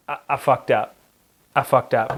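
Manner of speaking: low-pitched, subdued admission; sheepish confession. Retold or imitated. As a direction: own up haltingly, voice dropped a little. Retold confession to night crew guy.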